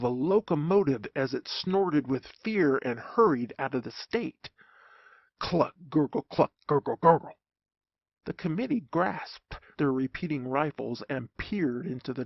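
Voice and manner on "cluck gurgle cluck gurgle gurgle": mosquito noises. Acted. Mosquito sounds imitated.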